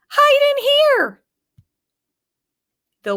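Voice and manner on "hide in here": urgent little pig. A narrator's reading. Pig urges brothers inside.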